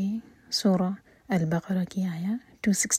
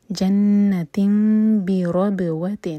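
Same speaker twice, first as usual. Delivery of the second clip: voiced, very slow tajweed recitation; slow Quran recitation; 7 dB louder, much slower than usual. Shown as a demonstration. Teacher recites the verse to show the rules, slowly. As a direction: draw each syllable out carefully. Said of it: "با پر قلقلہ".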